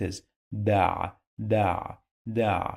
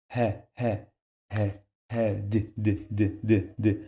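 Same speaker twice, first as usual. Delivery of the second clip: normal talking voice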